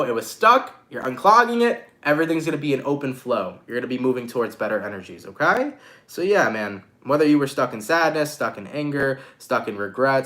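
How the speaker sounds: ordinary talk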